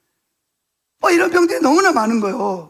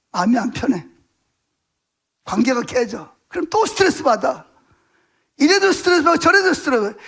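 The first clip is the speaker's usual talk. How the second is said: ordinary delivery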